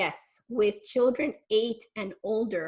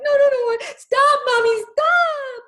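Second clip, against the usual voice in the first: put-on much higher and louder whining child protest; upset child; 13 dB louder, slower than usual. Upset child pushing mommy away, high loud whining protest. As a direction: wail it like an upset kid pushing mom away. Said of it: "when they're like".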